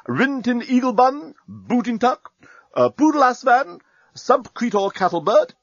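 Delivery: high, lilting list of names, each lifted at the end; officer's roll call. A narrator's reading. Captain introducing his corporals to Nettie, high roll-call voice. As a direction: rattle off the corporals with ceremonial lift.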